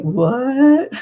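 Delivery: high, befuddled "What?" like she's barely there; dazed daughter. Retold or imitated. Grandmother imitating her medicated daughter, high and dazed. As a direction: play the drugged new mother, confused.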